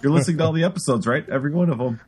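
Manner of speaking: quick, high, pushy probing; pry fast with a grin; fast suspicious probing. Retold or imitated. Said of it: "You're like".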